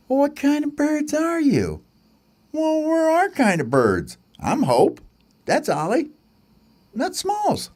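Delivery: high squeaky bird chatter; little bird voices. Acted. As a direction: introduce yourselves like small chicks. Birds question each other.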